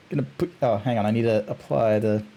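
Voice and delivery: usual speaking voice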